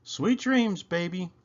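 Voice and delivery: a touch of cooing tenderness; soft coo. A narrator's reading. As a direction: coo goodnight to her child. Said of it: "she softly coos".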